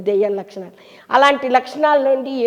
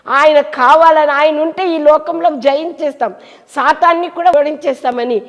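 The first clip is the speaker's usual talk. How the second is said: fervent preaching; 5 semitones higher, 6 dB louder than usual. Acted. Fiery preaching climax.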